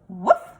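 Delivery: loud barked woof; dog woof. Acted. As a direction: bark it out like a dog. Dog answers Duck.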